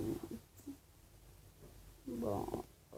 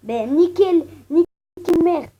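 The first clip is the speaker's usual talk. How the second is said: shouting; 4 semitones higher, 12 dB louder, slower than usual. Acted. Yelling at viewers for likes.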